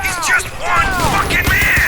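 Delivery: loud exasperated bellow; furious shout. Acted. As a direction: yell it in disbelief. Commander raging at failing squad.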